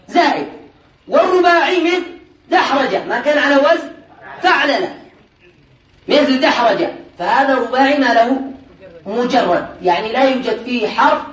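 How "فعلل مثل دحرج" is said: ordinary delivery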